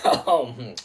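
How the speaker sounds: a light hint of a Russian accent, sly salesman; a Russian accent, smooth oil dealer; mock Russian oilman. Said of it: "And then Russia's gonna be like".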